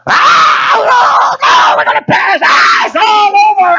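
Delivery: high, loud shrieks of panic; panicked screaming. Acted. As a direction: scream as if the ship is sinking. Disciples panic in the storm.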